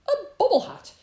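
high, loud rabbit exclamation; delighted cry. A narrator's reading. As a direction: cry out in excitement at a gift. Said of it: "he cried".

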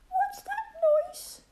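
very high, slow little mouse squeak; squeaky mouse. Acted. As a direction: squeak it like a tiny frightened mouse. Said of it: "he squeaked".

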